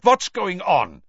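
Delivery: loud, high, slow demand; agitated shout with an accent; cried out. A narrator's reading. Karkaroff demanding answers over Krum, crying out. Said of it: "he cried".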